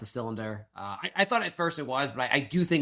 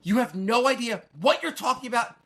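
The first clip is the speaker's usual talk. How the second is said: high-pitched, emphatic retold comeback; exasperated retort; 9 semitones higher, 3 dB louder than usual. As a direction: cut her off, stressing each word. Retold quarrel with wife.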